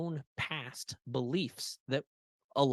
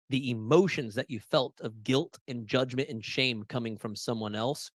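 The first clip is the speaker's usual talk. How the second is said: usual speaking voice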